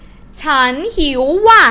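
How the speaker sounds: loud, impolite outburst; emotional. Shown as a demonstration. Teacher showing an impolite particle to students, emotional. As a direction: blurt it out loudly, with feeling. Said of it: "it started to get emotional".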